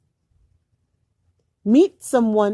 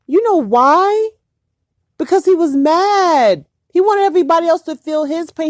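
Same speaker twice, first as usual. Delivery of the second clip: raised, indignant voice, higher and louder; outraged; 7 semitones higher, 6 dB louder than usual. Real, not acted. Venting to viewers about a man spreading HIV, loud and outraged. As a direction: let the outrage boil over.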